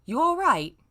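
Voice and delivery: asked up high and loud; voiced boyfriend asking out loud; loud concerned question. Character asks in reading.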